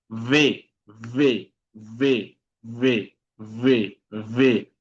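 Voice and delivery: "V" as a buzzed "vê", teeth on lip; labiodental V. Shown as a demonstration. Teacher drilling students on the sound, "V" with buzzing labiodental onset. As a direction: repeat "V" separately, each a clear Portuguese vê. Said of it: "es un sonido labio dental".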